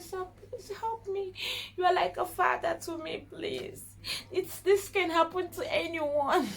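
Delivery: high, soft, breaking plea; tearful begging. Retold or imitated. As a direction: beg him like a crying child, almost whispered. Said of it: "I was crying, seriously".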